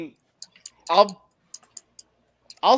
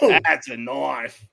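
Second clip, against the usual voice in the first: lower, very slow, drawn-out punchline, falling; alligator hunter quote; 6 semitones lower, much slower than usual. Retold or imitated. Quoting the alligator hunter showing a real knife, low slow proud fall. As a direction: unveil the big knife slowly and proudly. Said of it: "the alligator hunter".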